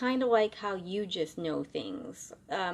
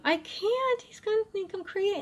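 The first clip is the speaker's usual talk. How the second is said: much higher, desperate whining protest; panicked plea; 13 semitones higher than usual. Retold or imitated. Bartender begging the spirit to leave, high panicked whine. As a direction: squeal it out like you're cornered.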